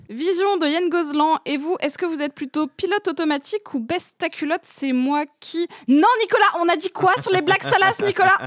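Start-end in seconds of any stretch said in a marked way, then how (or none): 5.9-8.4 raised, higher voice of a teacher telling a pupil off; host calls out Nicolas over dirty jokes, playful mock scolding. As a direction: scold Nicolas like a strict schoolmistress, half joking.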